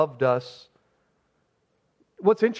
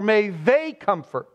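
"they" stretched and stressed, slowed; 3 semitones higher than usual. Shown as a demonstration. Pastor showing the wording Paul did not use, stress on "they". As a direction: hit "they" as the wrong plural reading. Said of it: "And he doesn't say".

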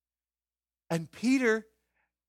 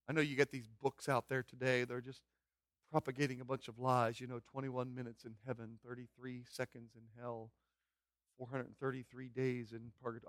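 quiet scorn; 6 semitones lower, 12 dB quieter than usual. Real, not acted. Soft dismissive aside.

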